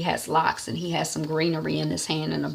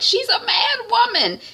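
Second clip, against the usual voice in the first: high, louder alarmed remark; alarmed little sister; 4 dB louder than usual. Retold or imitated. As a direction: warn the family about the stranger. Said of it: "Shaliwa is like".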